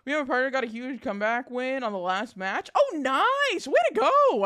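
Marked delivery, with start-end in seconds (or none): 2.7-4.4 excited cheer. Real, not acted. Cheering viewer's win.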